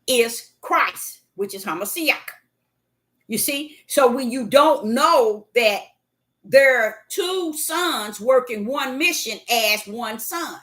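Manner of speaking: normal talking voice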